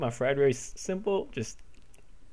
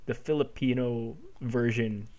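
halting, with long pauses; hesitant. Real, not acted. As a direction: feel your way cautiously through the answer. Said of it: "you're being so hesitant".

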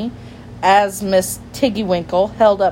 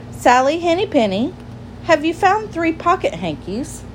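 high, eager questioning voice; lightly acted storybook child; young girl asking; 10 semitones higher than usual. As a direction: ask it high, like a curious little girl. Girl asks a hen, acted.

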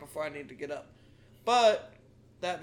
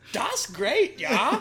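put-on higher, comic German accent; exaggerated German, pitched up; mock German accent; 6 semitones higher, 5 dB louder, much slower than usual. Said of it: "German is like".

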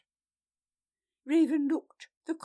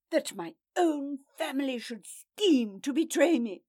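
loud, aggrieved voice; bitter lament; 5 semitones higher, 7 dB louder than usual. A narrator's reading. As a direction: rail at betrayal. Eurolf self-pitying rant.

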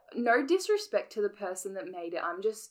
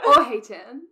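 cracking up as she reads it again; laughing; 3 semitones higher, 14 dB louder than usual. Shown as a demonstration. Rereading her favourite fanfic line to her co-host, laughing. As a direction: repeat it through loud laughter, louder. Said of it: "I love that line".

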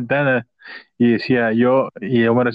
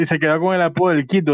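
ordinary talk

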